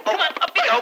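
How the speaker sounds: a gleeful heave, laughing; playful lift. Acted. Husband lifts wife across threshold.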